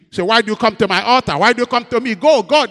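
loud, rising scolding voice of God; stern rebuke. Retold or imitated. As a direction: drive the boaster from the altar. Lord rebuking at altar.

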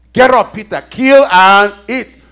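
loud, drawn-out divine command; commanding heavenly voice. Acted. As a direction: boom it out slowly as an order from above.

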